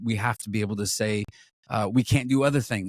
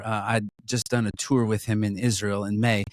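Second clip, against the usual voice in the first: ordinary talk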